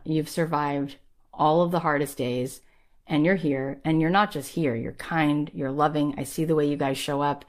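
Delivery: usual speaking voice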